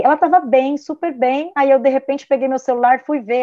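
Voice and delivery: normal talking voice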